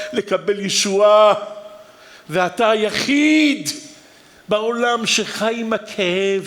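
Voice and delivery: impassioned preaching. Acted. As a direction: preach at full force, pleading. Preaching at full force.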